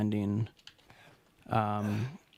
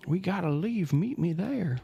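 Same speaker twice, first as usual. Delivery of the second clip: put-on Texas accent; 8 semitones higher than usual. Gamer reading a character's line aloud, in a Texas accent. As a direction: voice the line like a Texan cowboy. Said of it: "I did that in a Texas accent".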